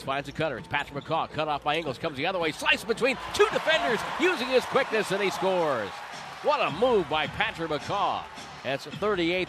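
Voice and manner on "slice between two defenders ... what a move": high, loud rush of play-by-play; excited call. Real, not acted. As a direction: ride the drive up to the score. Radio call of a drive.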